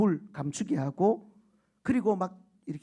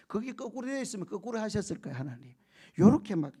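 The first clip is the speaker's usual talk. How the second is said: normal talking voice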